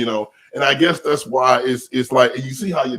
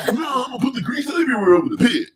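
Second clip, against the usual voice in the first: much higher, fast, whiny mock voice of the other guy; imitating the dude; 11 semitones higher, much faster than usual. Mocking the man who greased the pit, hammed-up whiny voice. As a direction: rattle it off as the annoying dude.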